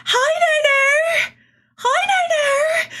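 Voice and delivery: very high, slow squealing greeting; imitating happy streamer. Imitating Kiki greeting him, high happy squeal. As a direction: squeal it like an overjoyed girl. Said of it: "and she's like".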